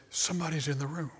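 hushed, fast and panicky; spooky frightened voice. Retold or imitated. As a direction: whisper it like someone's in the dark room. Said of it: "in a freaky, spooky voice".

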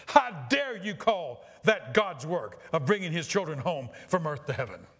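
fervent, declaimed challenge; impassioned indignation. Acted. Pastor rebuking a doctor who calls sickness God's work, impassioned. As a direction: hit each phrase with righteous force. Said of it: "You are too much excited".

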